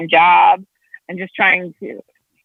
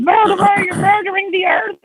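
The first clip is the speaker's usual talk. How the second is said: much higher mock scream of a child's meltdown; imitated screaming fit; 12 semitones higher than usual. Mother acting out son's fit at the yard worker, high mock screaming. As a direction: cry out high, mimicking a kid's tantrum. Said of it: "He started screaming at him".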